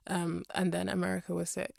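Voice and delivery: normal talking voice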